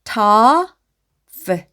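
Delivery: tough begun with a long held 'tuh'; sounding out. Shown as a demonstration. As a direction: stretch the vowel in tough. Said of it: "앞에서부터 읽어보면". (tough의 '터'를 길게 끌어서)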